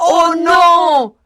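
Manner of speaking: cri aigu et consterné; cri des souris. Acted. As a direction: crie ta surprise, effrayé. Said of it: "crient les grosses souris". (les souris crient, consternées)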